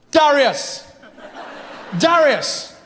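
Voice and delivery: calling a name across the beach; calling out to friend. Acted. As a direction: call him over twice, lively.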